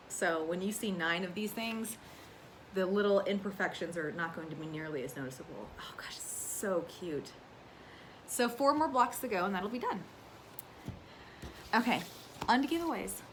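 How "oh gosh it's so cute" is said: soft, slow whisper of delight; hushed cooing over a cute quilt block. Real, not acted. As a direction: breathe it out like it's precious. Admiring her finished block.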